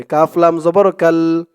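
ordinary delivery

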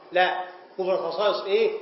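ordinary delivery

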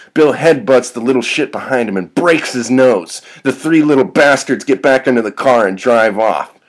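loud, high, driving fight narration; excited storytelling, punching the verbs; action narration. A narrator's reading. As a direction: call the brawl blow by blow.

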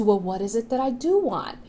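gently steer toward the question; gentle. Shown as a demonstration. Showing viewers the gentle inner question, softly. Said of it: "this gentle movement to".

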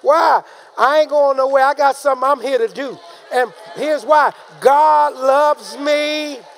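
forceful preaching. Real, not acted. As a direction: preach it boldly, stretch the last words.

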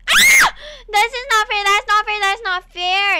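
high, loud protest, not hammered each time; outraged yell. Real, not acted. Gamer protesting to viewers that the enemy is unfair, loud outrage. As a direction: yell it like the game cheated you.

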